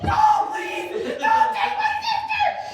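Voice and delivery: high, pleading mock scream; screaming sister. Sister at the door begging the police, hammed-up pleading scream. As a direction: wail like a frantic kid begging. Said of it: "my sister's screaming in the background".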